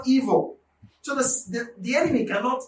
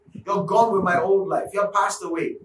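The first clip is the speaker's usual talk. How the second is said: normal talking voice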